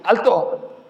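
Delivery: mock halt command. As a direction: pretend to stop the worshippers. Orden hipotética de Jesús. (orden de alto, fingida)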